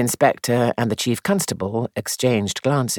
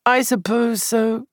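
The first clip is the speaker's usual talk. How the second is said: muffled, face buried in hands; 10 semitones higher, slower than usual. Acted. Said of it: "he said in a muffled voice".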